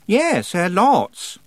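high, loud, hesitant boast; flustered girl. A narrator's reading. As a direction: answer too brightly in a high voice, unsure. Anagramma answers about her bag.

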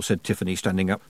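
plain reading voice

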